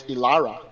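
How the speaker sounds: "Ilara" said with a clear L sound in the middle, no Y glide; double L as L. Shown as a demonstration. Correcting the player's name for his co-host, clear L in "Ilara". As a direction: give "Ilara" a crisp L where the double L is written. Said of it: "they don't use the Y sound for the double L".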